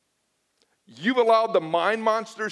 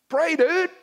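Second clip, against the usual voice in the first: pushy buddy; 6 semitones higher, 4 dB louder than usual. Retold or imitated. Buddy's reply in church.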